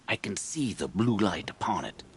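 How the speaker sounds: ordinary talk, nothing marked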